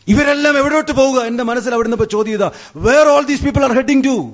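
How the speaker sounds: high-pitched, impassioned questioning; urgent outcry. Acted. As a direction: cry the question out over the crowd. Repeats his inner question, crying out. (ഉയർന്ന സ്വരത്തിൽ ആകുലമായി വിളിച്ചു ചോദിക്കുന്നു)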